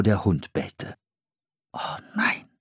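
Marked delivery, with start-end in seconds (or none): 1.7-2.5 soft, dismayed whisper. A narrator's reading. Boy realising they are discovered, dismayed whisper. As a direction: breathe it out softly in dismay. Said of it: "flüsterte Philipp".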